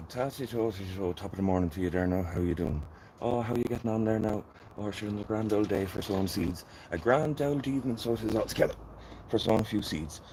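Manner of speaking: a hint of exaggerated Irish brogue; hammed-up Irish. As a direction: lay the Irish on thick for the viewers. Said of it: "Maybe I should Irish it up a little bit".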